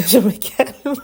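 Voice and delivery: laughing while trying to calm down. Real, not acted. Host giggling at her own excitement, laughing. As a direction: giggle it out, slowly.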